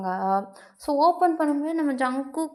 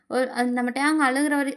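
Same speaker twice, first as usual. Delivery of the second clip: plain reading voice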